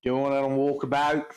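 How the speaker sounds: mock-Aussie echo; broad Australian vowels; Australian accent. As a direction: imitate an Australian saying it. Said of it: "maybe just do the Australian".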